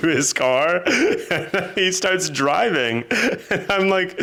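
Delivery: words broken by real laughter, pitch up high; laughing while telling. Real, not acted. Telling her friend about the bumper, laughing. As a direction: giggle through the story.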